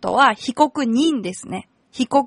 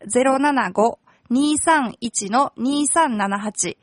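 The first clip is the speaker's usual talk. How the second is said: slow number read; much slower than usual. Shown as a demonstration. Reading out phone number.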